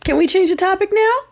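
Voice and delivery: sing-song high feigned innocence; mock innocence. Asking angry friends to drop the subject, in a sing-song mock-innocent voice.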